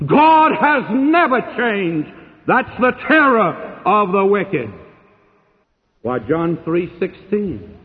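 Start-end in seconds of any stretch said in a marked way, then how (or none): 0.1-4.7 high, slow pulpit declaration; ringing, stretched-out proclamation; emphatic preaching. Real, not acted. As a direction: hammer it home with drawn-out weight. Preacher hammers home his main point.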